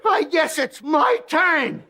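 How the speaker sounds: high, slow, strained voice; strained recovering guy. Acted. Guy gasping to the farmer after the kick. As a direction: squeeze it out, still hurting. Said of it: "he says to the farmer".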